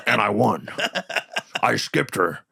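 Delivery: lightly voiced mock promoter; Vince McMahon impression. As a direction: gloat like a wrestling promoter. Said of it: "Vince McMahon would have ended".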